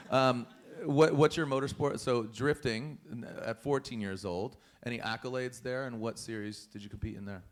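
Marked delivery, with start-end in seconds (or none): none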